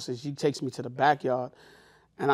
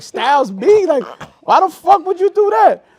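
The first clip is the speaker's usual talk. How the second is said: higher and louder, disbelieving; mock shocked yell; 14 semitones higher, 12 dB louder than usual. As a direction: shout in disbelief at her tattoo. Shocked at her tattoo.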